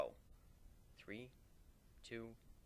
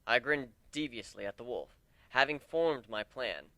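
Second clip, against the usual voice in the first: usual speaking voice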